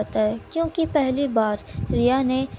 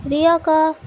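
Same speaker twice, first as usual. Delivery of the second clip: usual speaking voice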